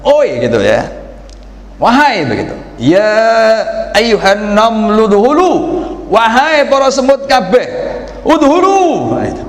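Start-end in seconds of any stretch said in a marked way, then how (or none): 0.0-0.4 shout. Shown as a demonstration. Preacher shows the ant shouting Oi. 1.8-2.3 calling out. Shown as a demonstration. Preacher voices the queen ant calling. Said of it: "wahai itu mesti bengok". 2.8-9.2 commanding queen ant. Shown as a demonstration. Queen ant shouting orders to her ants. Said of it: "wahai itu mesti bengok".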